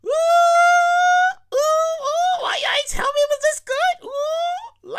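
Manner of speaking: high falsetto rapture over the food; ecstatic ooh. Real, not acted. Podcaster raving about the chicken sandwich, high ecstatic oohs. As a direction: swoon over the first bite.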